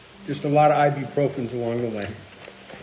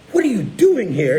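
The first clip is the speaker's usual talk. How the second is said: louder, higher, imitated demand; mock indignant questioning; retold sharp challenge; 6 semitones higher, 5 dB louder than usual. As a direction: act out a woman confronting intruders. Retold challenge from startled woman.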